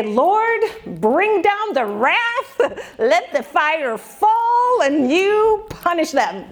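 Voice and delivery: playfully mock-thunder a vengeful prayer; mock wrath prayer. A mom jokingly praying wrath on her teenagers, hammed-up and high.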